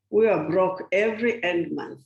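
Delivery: usual speaking voice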